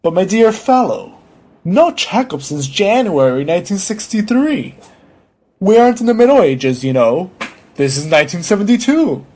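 pompous doctor's remonstrance; reproving doctor. Acted. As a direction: scold the patient in disbelief. Story figure: scolding, incredulous doctor.

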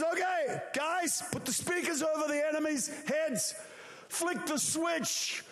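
high-pitched, full-voiced orders; preacher acting God's rousing command; shouted commands. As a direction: call out the big cue to the crew. God shouts commands to angels.

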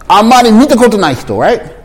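ordinary talk, nothing marked

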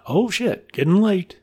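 high-pitched, put-on hasty excuse; mock excuse-making. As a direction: make a transparent excuse to get away. Birds voicing excuses to leave.

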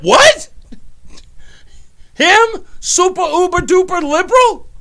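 high, loud, rising disbelief; retold incredulity. Recalling hearing a liberal say it, incredulous outburst. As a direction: replay being utterly floored, voice shooting up. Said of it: "I thought my head was going to explode".

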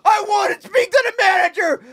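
high, shrill-free screaming Karen; man doing a woman's voice. Retold or imitated. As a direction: yell like an angry customer. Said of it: "That's a good impression of a lady".

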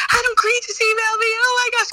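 very high, fast squeal of joy; squealing excitement. Retold or imitated. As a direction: shriek it out like the best news ever. Retelling excited reply to kids.

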